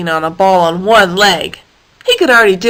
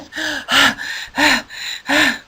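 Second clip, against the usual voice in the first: long, high, winded sigh; exhausted hippo sigh; 5 semitones higher, 4 dB quieter than usual. Acted. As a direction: puff it out, spent. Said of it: "he sounded like this".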